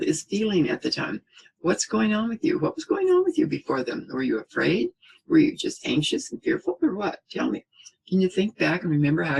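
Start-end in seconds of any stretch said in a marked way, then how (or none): 1.6-7.7 gently probing parent. Shown as a demonstration. Parent gently asking an upset child how they felt. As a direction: coax the child to name the feeling.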